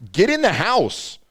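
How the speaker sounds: slow, high, loud commanding yell; urgent command. Retold or imitated. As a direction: order him inside, no argument. Ordering Drew inside, quoted.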